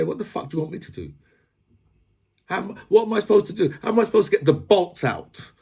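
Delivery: incredulous, high-pitched protest; baffled worker demanding to know how; exasperated mechanic. Acted.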